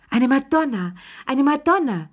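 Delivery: high, ecstatic exclamation; screeching. A narrator's reading. Charlotte Pelou correcting in a holy frenzy, screeching. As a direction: cry it out in holy rapture, pitched high. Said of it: "verbesserte Charlotte Pelou kreischend".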